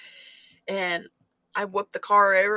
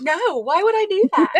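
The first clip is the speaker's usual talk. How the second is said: high, louder indignant refusal; imitating balking kid; 10 semitones higher, 5 dB louder than usual. Daughter refusing mom's dare, indignant. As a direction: push back in a pitched-up kid voice.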